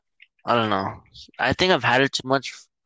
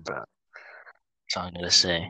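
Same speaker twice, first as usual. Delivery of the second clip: slow secretive whisper; 6 semitones lower, 7 dB quieter, slower than usual. Real, not acted. Whispered aside about ham.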